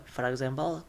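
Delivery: ordinary talk, nothing marked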